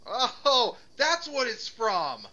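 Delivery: delighted shout of recognition; excited. Real, not acted. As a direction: burst out with sudden glee. Sudden recognition of the movie.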